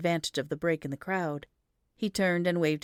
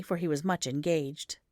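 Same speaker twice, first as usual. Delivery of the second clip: usual speaking voice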